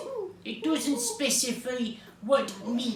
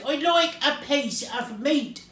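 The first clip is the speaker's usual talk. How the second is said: raised, higher shouted request; shouting; 6 semitones higher, 5 dB louder than usual. A narrator's reading. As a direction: call it out loudly. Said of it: "shouted Mister Noisy".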